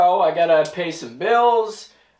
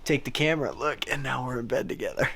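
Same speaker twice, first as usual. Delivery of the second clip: flirty voice; 5 semitones lower, 6 dB quieter, faster than usual. Pretend. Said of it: "that's so fucking cringy".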